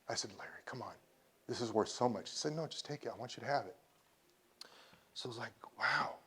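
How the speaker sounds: hushed, moved retelling; emotional. Real, not acted. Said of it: "I'm sorry I get emotional".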